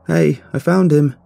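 high, loud, drawn-out call; shout. A narrator's reading. Narrator tells girlfriend the cat is found, shouting. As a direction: shout the good news across the house. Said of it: "I shouted to Gigi".

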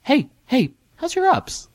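very high, squeaky mock voice; imagined look voiced. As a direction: squeak it out like a jokey aside. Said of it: "a look in your eyes like".

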